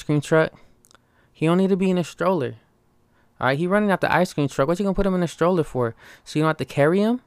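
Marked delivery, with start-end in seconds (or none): none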